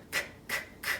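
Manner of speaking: "k" as a voiceless, soft click of breath; clicking camera sound. Shown as a demonstration. Teacher modelling the camera sound, "k" as a bare voiceless click. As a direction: say "k" with no vowel, just the consonant. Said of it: "Do that with me".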